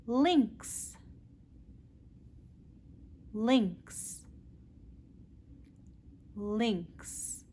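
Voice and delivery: lynx, with a clear L, said slowly; teacher repeating lynx; modelled L word. Shown as a demonstration. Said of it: "Practice with me".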